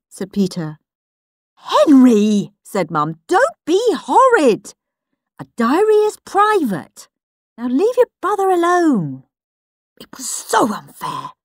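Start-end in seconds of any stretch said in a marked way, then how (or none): 1.5-9.2 stern mum. Acted. Mum scolding.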